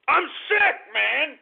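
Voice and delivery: high, loud burst of disgust; exasperated high-pitched cry; fed-up yell. Real, not acted. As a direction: snap it out loudly, at wits' end. Fed up outburst.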